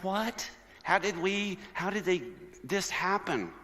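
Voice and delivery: high, baffled disbelief. Retold or imitated. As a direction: throw your hands up in confusion. Bewildered parent, quoted.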